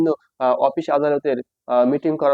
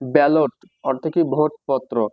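ordinary talk

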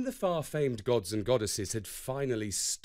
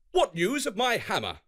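Thor's question; 7 semitones higher, 4 dB louder than usual. A narrator's reading. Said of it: "Thor inquired".

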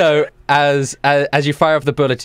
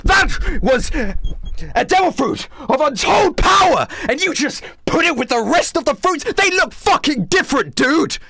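furious commander; 10 semitones higher than usual. Acted. Commander yells at the sailor who misplaced the devil fruit, furious. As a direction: chew him out for his stupidity.

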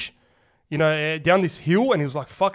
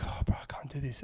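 whisper; 4 semitones lower, 14 dB quieter than usual. Retold or imitated. Exhausted plea on the phone.

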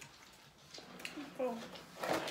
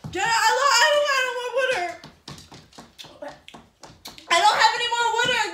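loud high frantic pleading; frantic, fast, desperate; panicked; 6 semitones higher, 8 dB louder, faster than usual. Real, not acted. Panicking from spicy heat.

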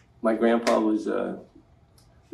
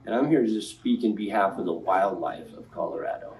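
usual speaking voice

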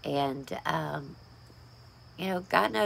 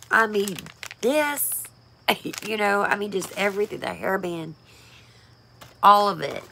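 choked up; 4 semitones higher, 4 dB louder than usual. Real, not acted. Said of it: "I'm not gonna start crying again".

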